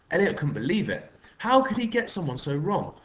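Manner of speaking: usual speaking voice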